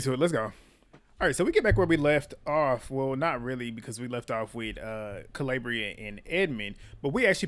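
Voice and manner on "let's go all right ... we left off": ordinary talk, nothing marked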